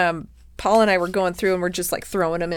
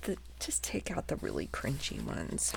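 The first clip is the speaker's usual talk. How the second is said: whisper; 7 semitones lower, 17 dB quieter than usual. Real, not acted. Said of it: "whispered suggestion".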